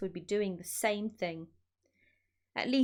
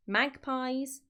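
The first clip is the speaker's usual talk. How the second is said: ordinary delivery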